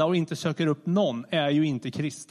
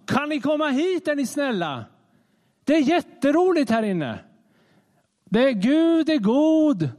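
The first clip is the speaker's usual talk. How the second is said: higher, sing-song calling out from afar; mock calling out; 7 semitones higher than usual. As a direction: beckon outsiders in with hammed-up cheer. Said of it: "vi får bara ropa ut".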